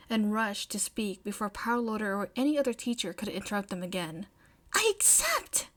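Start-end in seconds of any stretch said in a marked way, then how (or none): 4.7-5.7 high excited outburst; excited May. Acted. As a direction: blurt it out with joy. May blurts acceptance, excited.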